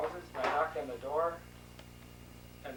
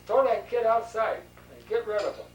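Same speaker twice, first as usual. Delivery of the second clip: loud, higher retold command; acted impatient demand, pushed louder; exasperated order; 5 semitones higher, 8 dB louder than usual. Woman at the door wanting the kid out, exasperated order.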